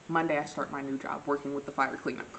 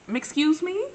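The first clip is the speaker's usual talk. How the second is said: loud, high incredulous question; acted disbelief; 11 semitones higher, 7 dB louder, slower than usual. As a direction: shoot up in pitch, mock-offended. Acts out her disbelief at friend.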